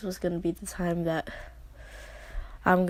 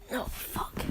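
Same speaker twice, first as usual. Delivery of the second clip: breathy, voiceless no, fuck; whispered curse; 9 dB quieter than usual. Retold or imitated. Breaking down after saying goodbye to grandpa, whispered. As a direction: let it slip under your breath.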